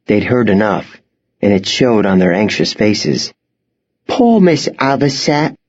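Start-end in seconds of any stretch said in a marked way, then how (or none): 4.1-5.5 put-on higher, whimpering little girl. A narrator's reading. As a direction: whimper it with pity, lip trembling. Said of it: "Claire whimpered".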